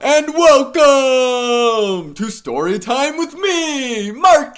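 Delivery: high, slow, bright welcome to the show; sing-song story time greeting; cheery host voice. Acted. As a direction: greet the kids like a kids' TV host. Host opens the story video.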